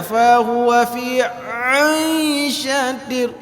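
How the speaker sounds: teacher mocking a bad reading; mock wrong ain. Shown as a demonstration. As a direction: push the ain up and thick. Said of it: "He ni gede".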